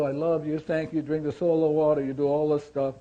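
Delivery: usual speaking voice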